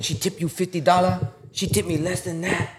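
high-pitched, put-on Vietnamese accent; mock-outraged Vietnamese-accent impression; Tuan's Vietnamese accent. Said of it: "He talk like that".

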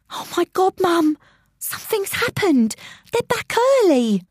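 high-pitched panicked cry. Acted. Child alerting Mum to noises upstairs, panicked.

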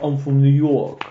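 badly faked New York accent; terrible New York accent. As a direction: try a New Yorker's accent and miss. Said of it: "That's a terrible accent".

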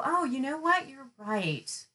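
soft, high mock realization dropping on the admission; narcissist giving in. Imagined narcissist conceding to the accuser, mock agreeable. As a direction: play a sudden, unlikely concession in a small voice. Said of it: "the narcissist isn't gonna go".

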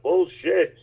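high mock heckler shout from the crowd; heckler yell. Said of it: "the guy yells out".

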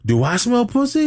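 high, mocking squeak of a tough guy; high-pitched mocking jeer; mock taunt. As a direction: needle him in a high voice. Retold taunt from the movie.

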